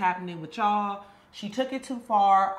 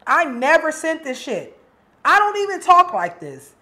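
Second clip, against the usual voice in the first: outraged denial; 5 semitones higher, 10 dB louder than usual. Acted. Angry denial over fake texts.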